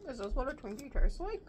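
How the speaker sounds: talking while chewing; mouth full of popcorn. Real, not acted. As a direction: hand over mouth. Seen 0: Left woman ate popcorn at -5, covers mouth chewing; speaker uncertain.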